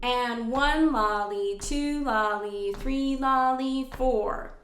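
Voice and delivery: slow, steady triplet count; rhythm demo. Shown as a demonstration. Teacher demonstrating measure four's rhythm, slow and strictly in time. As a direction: chant each beat in three even syllables. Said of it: "like that".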